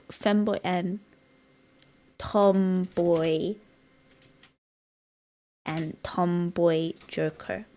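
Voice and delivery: very slow, spaced-out words; dictating slowly. Real, not acted. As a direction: say it as you type it. Dictating a message while typing.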